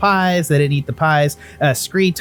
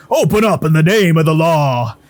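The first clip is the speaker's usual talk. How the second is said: loud, raised command; lawman's shout; 4 semitones higher, 6 dB louder than usual. Acted. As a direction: shout it like the law at the door. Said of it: "and he says".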